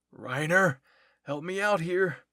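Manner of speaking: shouting for help. Acted. Struggling fighter calls Reiner for help, shouting. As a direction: yell to your ally over a struggle.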